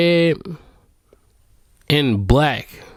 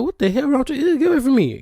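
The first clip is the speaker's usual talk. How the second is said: annoyed; 9 semitones higher, faster than usual. Retold or imitated. Annoyed kid shoos crying girl.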